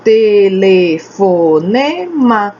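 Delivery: TE-LE-FO-NE-MA, five slow beats; syllable by syllable. Shown as a demonstration. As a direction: say telefonema very slowly, every syllable held. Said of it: "São cinco sílabas".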